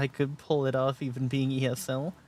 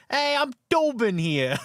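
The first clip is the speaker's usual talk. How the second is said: higher hammed-up New York accent greeting; mock New York accent; 9 semitones higher than usual. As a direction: play a brash New Yorker saying hi, pitched up. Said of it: "part of the New York accent".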